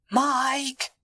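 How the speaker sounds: high-pitched call after a friend; high, stretched-out name. A narrator's reading. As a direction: call out to someone leaving. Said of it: "Edward called out".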